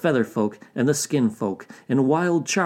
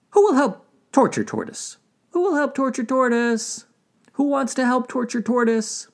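high animal rabble-rouser; rally the crowd in a high voice; rallying beaver; 13 semitones higher than usual. A narrator's reading. Beaver rallies the animals against Tortoise, high rallying call.

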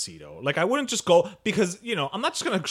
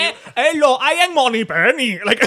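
mock Cuban-accented British, much higher and louder; 10 semitones higher, 12 dB louder than usual. Playing Money Penny at party, Cuban-British accent. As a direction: ham up a Cuban doing posh British. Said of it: "Cuban accented British accent".

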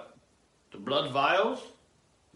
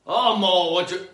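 calling out; 9 semitones higher, 14 dB louder than usual. Real, not acted. Calling out to co-host.

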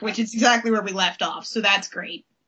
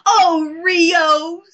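higher, louder melodramatic wail; hammed-up swoon; 7 semitones higher, 5 dB louder than usual. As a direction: gush the name like a soap heroine. Mimics Jerrica's repeated lovelorn cry.

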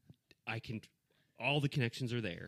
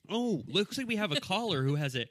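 hammed-up radio host intro; playful talk-show announcer, louder; 5 semitones higher, 6 dB louder than usual. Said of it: "we'll do it in robot voice".

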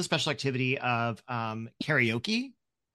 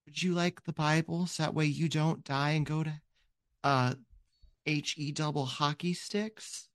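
put-on ten-year-old girl; 2 semitones higher than usual. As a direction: play an earnest child handing out Bibles. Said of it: "She's like".

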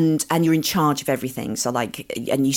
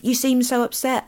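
sympathetic mum; 10 semitones higher than usual. Shown as a demonstration. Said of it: "you have to say what you see".

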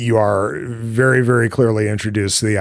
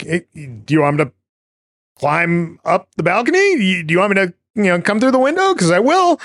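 rising higher into a fast, keen offer; eager boyfriend; 9 semitones higher, faster than usual. Retold or imitated. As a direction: pitch climbing as he offers to climb up. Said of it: "And he's like".

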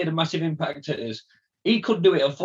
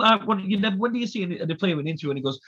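ordinary talk, nothing marked

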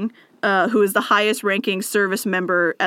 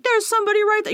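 dog voice; 12 semitones higher, faster than usual. Retold or imitated.

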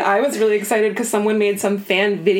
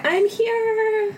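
pretend arrival; 13 semitones higher than usual. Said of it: "I'd be like".

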